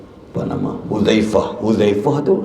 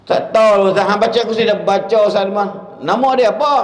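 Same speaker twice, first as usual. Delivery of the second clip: high mock father; 9 semitones higher, 3 dB louder, faster than usual. Father answers the ustaz.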